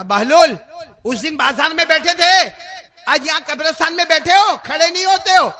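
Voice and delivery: loud, high angry scolding; the king shouts at the man who will not stand; furious royal rebuke. Acted. King angrily confronts Bahlul again.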